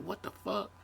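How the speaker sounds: high, soft, disbelieving "What the fuck?"; a jolt of shocked disbelief; stunned outrage. Retold or imitated. Hit by an offhand remark after a bad day, stunned and high. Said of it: "I'm like".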